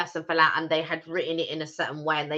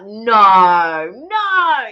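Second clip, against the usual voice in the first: loud, drawn-out no in mock shock; stunned, exaggerated denial; disbelieving; 3 semitones higher, 11 dB louder than usual. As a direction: relive the disbelief, jumping high on the second no. Reliving shock at finding out.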